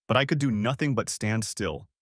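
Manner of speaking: ordinary delivery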